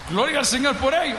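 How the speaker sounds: preacher shouting praise to the congregation. Real, not acted. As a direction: shout glory to God. Preacher praising God aloud.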